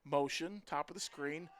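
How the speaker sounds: normal talking voice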